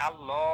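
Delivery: আল্লাহ ending in a long rounded "lo"; heavy l, held o in আল্লাহ; thick "lo" sound. Shown as a demonstration. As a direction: stretch আল্লাহ as "Allo". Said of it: "তো যখন মোটা হয়েছে".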